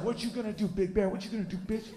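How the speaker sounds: high, soft taunting rooster voice; cocky rooster. Retold or imitated. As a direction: sneer at Big Bear like a cocky rooster. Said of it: "the rooster's like".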